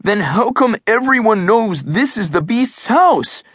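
high-pitched, insistent challenge; indignant kid's question; demanding. A narrator's reading. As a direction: demand an answer, pitch right up. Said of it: "Eugene demanded".

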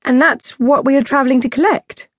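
a lightly voiced curious Zoe. Zoe asking the princess her age, lightly voiced.

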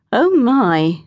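ordinary delivery, nothing marked

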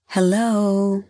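high, drawn-out, mock-cheery phone greeting; overly enthusiastic hello, held high; sarcastic enthusiasm. A narrator's reading. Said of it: "in her sarcastic, enthusiastic voice".

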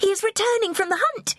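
put-on high-pitched girlish announcement; young princess crying out; excited cry. A narrator's reading. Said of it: "she cried".

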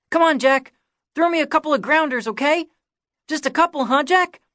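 put-on high, loud, fast little-boy pleading; boy begging his hero for grounders; begging kid. A narrator's reading. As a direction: wheedle like a kid who wants to play. Kiki begging to play.

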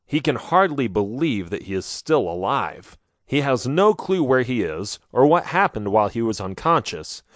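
usual speaking voice

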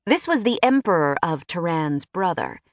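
ordinary delivery

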